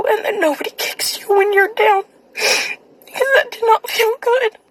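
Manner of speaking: slow, high, tearful; crying. Real, not acted. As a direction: let the voice break on it. Ends message in tears.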